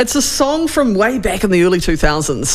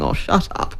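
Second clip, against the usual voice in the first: muttered shut up; 8 semitones lower, 5 dB quieter than usual. Retold or imitated.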